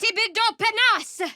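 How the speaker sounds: very high, loud spell cry; shouted incantation. Acted. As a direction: hurl the spell out. Casting a spell.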